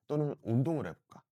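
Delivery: usual speaking voice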